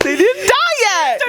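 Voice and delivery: laughing through it, high and slow. Real, not acted. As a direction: say it while cracking up.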